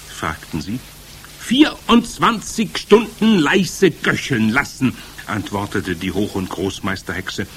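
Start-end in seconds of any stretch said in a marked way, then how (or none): 1.4-4.9 high, slow, loud witch voice; instructing Grand High Witch. A narrator's reading. Witch leader dictating her recipe, commanding.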